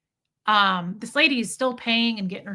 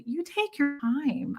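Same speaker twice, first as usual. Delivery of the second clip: slow, higher-pitched reassurance; pointedly kind; 5 semitones higher, much slower than usual. Retold or imitated. Customer reassuring the overworked clerk, pointedly kind. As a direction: draw it out kindly.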